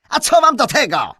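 loud, high, snapping nobleman; snarling. A narrator's reading. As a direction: snarl it at the stranger. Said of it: "warknął".

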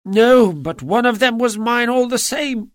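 high, whining plea; whined. A narrator's reading. Little man whining to the angry salesman. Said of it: "whined the little man".

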